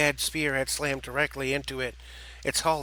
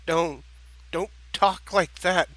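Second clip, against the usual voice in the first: stammering, higher and louder in pain; panicked stammer; 5 semitones higher, 3 dB louder than usual. Acted. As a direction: choke out the words in panic. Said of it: "speak through his own panic and pain".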